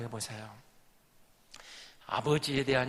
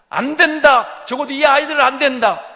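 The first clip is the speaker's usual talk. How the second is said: loud, higher refusal; father's refusal; 5 semitones higher, 10 dB louder than usual. Acted. Father refusing to send the sons, loud. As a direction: forbid it firmly, voice raised.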